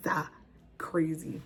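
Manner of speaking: usual speaking voice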